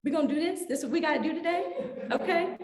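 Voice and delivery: sarcastic look. Retold or imitated. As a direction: needle them with a sarcastic question. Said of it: "watched them with my eyes just looking at them like".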